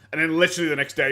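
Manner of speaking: ordinary talk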